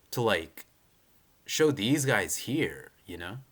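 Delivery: ordinary delivery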